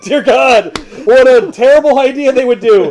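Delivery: laughing mock outrage. Real, not acted.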